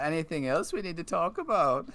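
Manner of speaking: put-on high, fast Irish accent; quick put-on Irish accent. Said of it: "That's Irish!".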